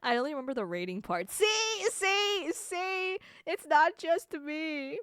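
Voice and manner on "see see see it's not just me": high, squeaky insistence; high-pitched gleeful vindication; triumphant vindication. Real, not acted. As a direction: repeat it in a high I-told-you-so squeal. Vindicated by chat's remark.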